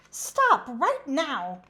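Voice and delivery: loud slow firm command; crying out. Acted. Kate ordering her horse to stop, loud slow command. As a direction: order it slowly and firmly. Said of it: "cried Cowgirl Kate".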